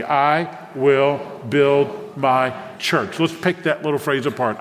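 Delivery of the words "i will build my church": very slow, separated words for the congregation to follow; drawn out, deliberate group recitation. Shown as a demonstration. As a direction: lead them in saying it aloud, one word at a time. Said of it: "Say that out loud with me".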